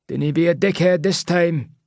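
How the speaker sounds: high Scottish sneer; warning Scottish accent. A narrator's reading. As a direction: warn him off in broad Scottish. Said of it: "he says in a Scottish accent".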